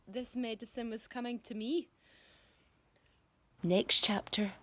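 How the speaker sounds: plain reading voice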